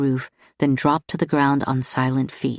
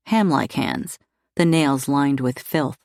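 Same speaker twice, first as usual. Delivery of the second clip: plain reading voice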